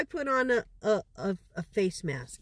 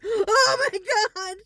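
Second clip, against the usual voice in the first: alarmed grandson; 9 semitones higher than usual. Retold or imitated. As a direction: voice a frightened boy shouting. Grandson panics seeing her.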